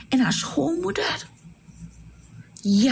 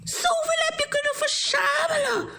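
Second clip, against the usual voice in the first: much higher, astonished cry to Ruth; amazed calling out. Acted. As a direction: call out in amazed delight. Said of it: "En ze riep".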